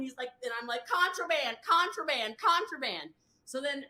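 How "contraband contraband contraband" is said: high, repeated accusing chant; mock alarm call. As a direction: call it out like an alert. Calling out the forbidden candy.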